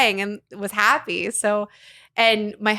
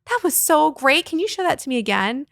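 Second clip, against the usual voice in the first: excited praise; 6 semitones higher, 4 dB louder than usual. Retold or imitated. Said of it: "I'm like".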